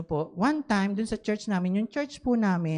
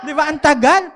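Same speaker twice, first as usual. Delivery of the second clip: louder, laughing through the words; 6 semitones higher, 9 dB louder than usual. Real, not acted. Pastor laughing with the congregation, louder. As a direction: laugh while asking.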